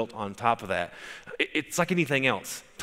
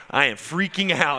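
louder, higher imitation of the amazed man; second, bigger take of the amazed quote; building excitement; 5 semitones higher, 5 dB louder than usual. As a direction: push the repeat up into a mock exclaim. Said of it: "walking around in the foyer going".

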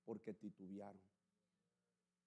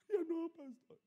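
mock frightened member; 12 dB louder, slower than usual. Frightened member declines prayer.

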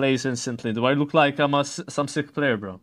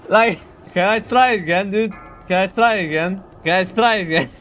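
loud, raised pleading through amusement; pleading for retry; 4 semitones higher, 5 dB louder than usual. Real, not acted. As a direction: beg for another go, half laughing. Begs to restart the failed challenge.

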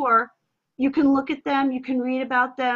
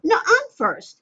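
put-on high, louder childish insistence; like a five-year-old; 7 semitones higher, 5 dB louder than usual. Shown as a demonstration. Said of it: "I'm like a five-year-old".

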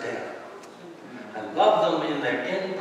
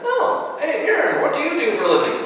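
high-pitched, louder playful imitation; mock innocent question; 11 semitones higher, 5 dB louder than usual. Gamer asks preacher's brother about his job, high mock-innocent voice.

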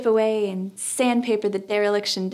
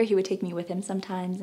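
usual speaking voice

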